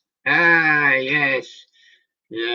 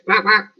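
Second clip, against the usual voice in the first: high squeaky quack quack; duck quacking; 8 semitones higher, 8 dB louder than usual. Pretend. Imitating a duck for viewers.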